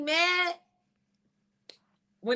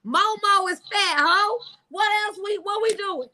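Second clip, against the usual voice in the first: high-pitched mock challenge; taunting clap-back; 6 semitones higher than usual. Woman dragging his grandma in an acted argument, taunting. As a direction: taunt him like you're ready to go.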